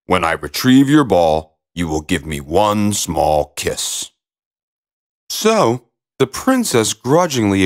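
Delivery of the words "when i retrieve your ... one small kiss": low frog voice, slowing at the end; low, deliberate storybook frog; bargaining frog. A narrator's reading. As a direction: lay down terms as a low-pitched frog. Frog states his condition.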